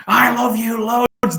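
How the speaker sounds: loud, high, drawn-out doting voice; talking to a dog. Shown as a demonstration. Owner showing how tone matters to a dog, high gushing dog-talk voice. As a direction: gush it slowly, pitched right up and loud. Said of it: "tone of the voice when you talk to a dog".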